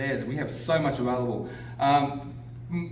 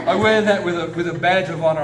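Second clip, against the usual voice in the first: loud laugh into the words; beaming and louder; laughing proudly; 3 semitones higher, 8 dB louder than usual. Real, not acted. Speaker proud of being called British Texan, laughing loudly.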